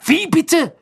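outraged high shout; angry roar. A narrator's reading. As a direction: explode in loud indignation. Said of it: "brüllte Ron zornig".

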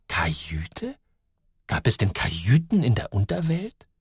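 whispered, rising puzzled word; whispered wonder. A narrator's reading. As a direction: murmur the strange word to yourself. Joel's puzzled thought. (verwundert geflüstert)

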